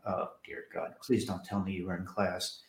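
dropping to a hushed, mortified murmur; dismayed hush. Retold or imitated. Telling Judith he dreads she heard it, softly dismayed. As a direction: sink into a dreaded whisper-soft plea.

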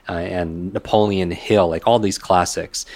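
ordinary talk

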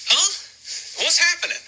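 puzzled friends staring at each other; bewildered high-pitched what-just-happened; confused. Retold or imitated. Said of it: "looking at each other like".

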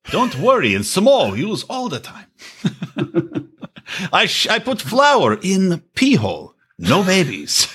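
slow, lightly put-on Samoan accent; mock Samoan accent, half laughing; imitating Asuelu. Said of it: "I'm trying to sound like Asuelu".

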